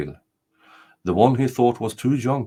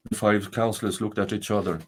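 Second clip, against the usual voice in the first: usual speaking voice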